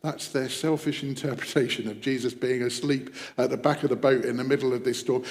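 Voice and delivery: normal talking voice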